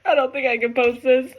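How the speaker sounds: teary voice; real tears, can't go on; choked-up admission; crying. Real, not acted. Crying over the reading.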